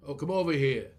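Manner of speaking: Philly hard R, loud and broad; Philly accent. Shown as a demonstration. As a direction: lean into the Philadelphia R. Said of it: "we go here like that with a horrible hard R".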